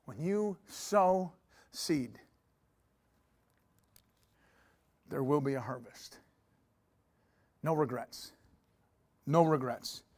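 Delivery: slow, soft, low sermon voice; soft, slow preacher voice almost down to a murmur; hushed preaching. Acted. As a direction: draw them in with a hushed, slow delivery. Soft, slow sowing message.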